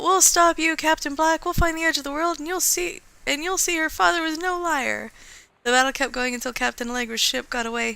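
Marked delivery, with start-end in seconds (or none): 0.0-5.1 higher, defiant storybook yell. Acted. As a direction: call out a challenge to the enemy. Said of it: "First mate yelled out".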